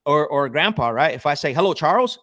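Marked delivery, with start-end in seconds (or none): 1.5-2.2 louder, higher firm greeting; confident cold-call opener; assertive. Shown as a demonstration. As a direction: own the call from the first word. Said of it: "I'm very assertive".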